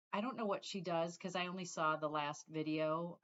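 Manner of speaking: ordinary talk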